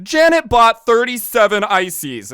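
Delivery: high, loud, slow mock word-problem voice; put-on textbook voice, stretched and loud; math problem reader. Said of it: "read about in a math problem".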